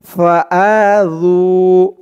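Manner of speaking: slow melodic tajweed chant, drawn-out vowels; Quran recitation. Shown as a demonstration. Teacher recites the verse for viewers, in slow melodic chant. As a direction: recite it like tilawat, stretching every long vowel.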